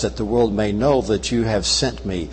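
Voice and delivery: usual speaking voice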